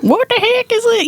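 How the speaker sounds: squeaky high mock bafflement; high, puzzled self-mockery; mock baffled. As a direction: go way up in pitch, puzzled. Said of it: "I was like".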